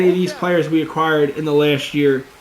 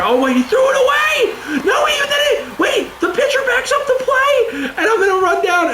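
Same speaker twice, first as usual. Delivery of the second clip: very high shrieking disbelief, laughing through it; frantic shouting. Real, not acted. Gamer screaming to viewers as his runner gets caught, frantic and laughing. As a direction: yell in panicked delight as the play goes wrong. Chaotic baserunning play unfolds live.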